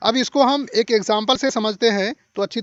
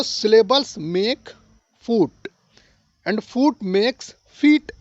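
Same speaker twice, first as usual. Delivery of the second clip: slow dictation; much slower than usual. Shown as a demonstration. Teacher spelling out a chain.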